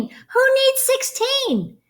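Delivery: put-on high, slow, cheeky child voice; defiant kid retort. Boy sulking at teacher, high and slow. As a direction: stretch it out high like a sulky kid.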